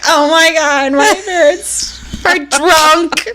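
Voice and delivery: high, mock-appalled child; hammed-up dismay, breaking into laughter; horrified kids. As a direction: imitate a scandalised kid, slowing down. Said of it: "they're like".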